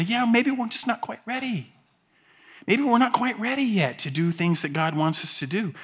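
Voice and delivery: high, soft mock hesitation; mock reluctance. Church putting off what God wants, mock reluctance. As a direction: hedge in a small, high voice. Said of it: "for the Church of Jesus Christ to say".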